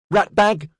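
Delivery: put-on high, loud little girl's insult; child's shout. A narrator's reading. Little girl shouting at the housebot, high and loud. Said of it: "Elsie shouted at the bot".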